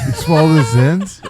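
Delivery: stretched-out, amused astonishment; amazed disbelief. Real, not acted. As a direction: laugh out a shocked question. Reacting to cohost swallowing pouch.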